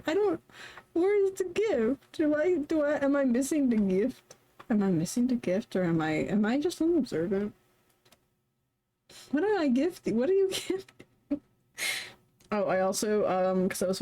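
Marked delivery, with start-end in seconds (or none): 0.1-11.4 much higher, puzzled and laughing; bewildered by a gift, pitch up; confused. Real, not acted.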